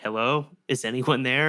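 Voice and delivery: a light hammed-up axe-killer call through the door; Jack Nicholson. Imitating the axe killer calling out, lightly creepy. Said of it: "Goes full Jack Nicholson on him".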